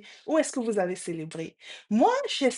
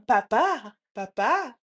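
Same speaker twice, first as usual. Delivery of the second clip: tag echo; 3 semitones higher, 3 dB louder, slower than usual. Retold or imitated. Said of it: "une voix qui retentit au loin".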